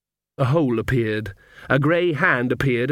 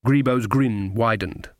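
usual speaking voice

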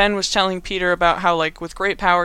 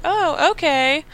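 high, drawn-out okay; put-on high girlish acceptance; mock coy girl; 9 semitones higher, slower than usual. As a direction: lilt it up high and slow, imitating a girl. MJ accepts Flash's car invitation.

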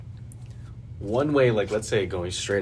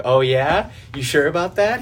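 higher and louder, sarcastic teasing; mocking taunt; 6 semitones higher, 8 dB louder than usual. Driver mocking the angry woman, loud sarcastic taunt. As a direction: taunt her like you don't care. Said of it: "He was, like, mocking her the whole time".

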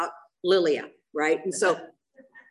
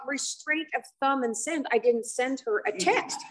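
ordinary talk

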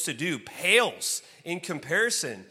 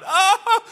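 high, loud cry of helpless laughter; imitated hysterical wail; acted laughing yell; 13 dB louder than usual. Man hitting the ground in laughter, high acted yell. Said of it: "I'm, like, hitting the ground".

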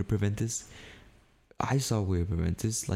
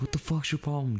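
high-pitched indignant challenge; acted-out exasperation, pitch jumped up; retold angry retort; 9 semitones higher than usual. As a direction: replay your challenge to the boss. Repeats own retort from quarrel.

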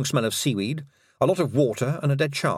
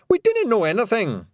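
high, loud Scots denial; loud Scots pixie protest; shouting Rob; 11 semitones higher, 4 dB louder than usual. Acted. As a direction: bluster your innocence. Rob Anybody.

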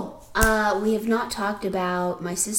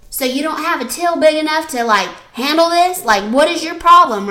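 louder, higher indignant challenge; retold exasperation; 6 semitones higher, 7 dB louder than usual. Sarah challenging the cashier over the till, rising anger. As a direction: build up from puzzled to fed up. Sister confronting clerk.

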